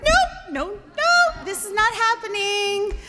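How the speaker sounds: high, loud string of protests; alarmed refusal. Retold or imitated. Daughter refusing the stranger's boat, alarmed protest. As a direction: protest in rising panic.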